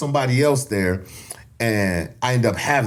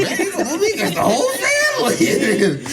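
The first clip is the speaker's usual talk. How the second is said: laughing disbelief. Retold or imitated. Repeat with bigger laugh.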